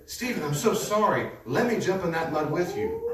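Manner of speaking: exaggerated pretend sympathy; mock sympathy. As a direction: play the foolish helper gushing sorry. Hypothetical mock-sympathetic offer.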